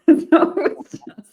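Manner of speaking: high laugh through the words. Real, not acted. Laughing to the interviewer about being outnumbered at the conference.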